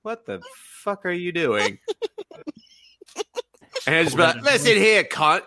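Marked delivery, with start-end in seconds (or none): 0.0-1.8 lightly voiced teacher. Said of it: "the teacher's gonna be like". 4.4-5.4 put-on British schoolboy bully, pitched up; bad British accent. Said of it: "pad British accent".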